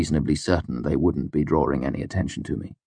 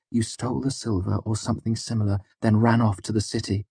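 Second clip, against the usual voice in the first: higher-voiced, understated exasperation; exasperated; 5 semitones higher than usual. A narrator's reading. Denner guessing why the girl ran away, mildly exasperated. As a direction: guess it with tired impatience. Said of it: "sounding exasperated".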